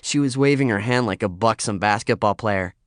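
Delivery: usual speaking voice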